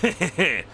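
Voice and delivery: long mock battle scream; powering-up scream. Imitating the hero powering up, long scream. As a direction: draw the yell right out. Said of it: "goes back to screaming".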